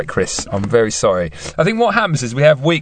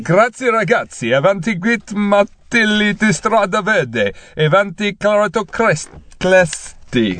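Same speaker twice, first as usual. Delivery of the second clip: higher, slow mock Italian accent; hammed-up Italian accent; 6 semitones higher, slower than usual. On request, he hams up Paul's slow, high Italian accent. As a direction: ham up a fake Italian accent, stretching each word. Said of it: "do your Italian accent for Paul the Bubble".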